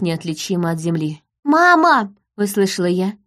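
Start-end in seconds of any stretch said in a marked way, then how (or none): 1.4-2.1 high, loud cry for mother; frightened child's call. A narrator's reading. Daughter calling her mother in a blizzard, high shout.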